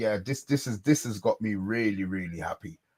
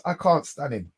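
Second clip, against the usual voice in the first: ordinary talk, nothing marked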